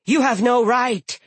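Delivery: high, loud hostile threat; angry. Acted. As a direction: threaten him with fury. Giantess keeps threatening.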